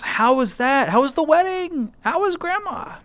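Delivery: climbing excitement, question after question; excited questions. Real, not acted. Streamer asking chat about their weekend, rising excitement.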